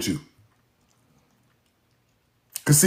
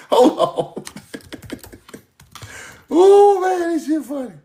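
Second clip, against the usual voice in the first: laughing; 10 semitones higher than usual. Real, not acted. Cracking up at own joke.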